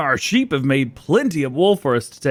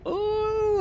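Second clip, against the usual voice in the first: admiring ooh; 6 dB quieter than usual. Real, not acted. Admiring his chrome four-wheeler.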